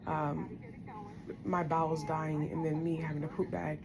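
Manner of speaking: quiet, holding back tears; emotional, subdued. Real, not acted. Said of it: "I'm getting emotional again".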